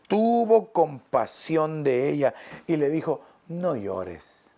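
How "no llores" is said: soft and low, gently comforting; quiet tender voice of the Lord. Acted. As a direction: hush it like consoling a grieving mother. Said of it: "¿cómo habrán sido esas palabras del Señor Jesús?".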